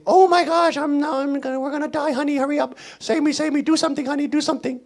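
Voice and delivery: high-pitched panicky wife pleading; mock freaking out. Imitating a wife begging her husband, mock panic. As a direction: babble helplessly in a put-on high voice. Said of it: "everybody freaking out and saying".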